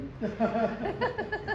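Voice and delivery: quiet amused chuckle; soft laughter; soft real laugh. Real, not acted. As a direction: laugh softly at yourself. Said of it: "I was deadly".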